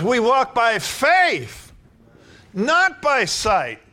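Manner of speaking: slow, high, loud preacher's proclamation; slow, booming sermon delivery; full-force preaching. Acted. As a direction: ring it out over the congregation.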